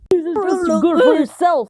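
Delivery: loud and high, shoving it on him; shouting. Acted. Zoro shouting at Luffy as he shoves the glass, loud. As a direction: shout it with mean glee. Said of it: "as he shouted out".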